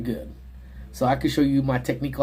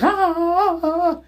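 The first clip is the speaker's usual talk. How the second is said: mock cries of pain; 4 dB louder than usual. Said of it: "And she's like".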